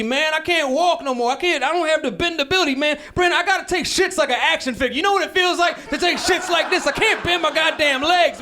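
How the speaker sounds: dad's cranky refusal, hammed up; grumpy old dad. Dad refusing son's museum trip, high cranky rant. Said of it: "He's like".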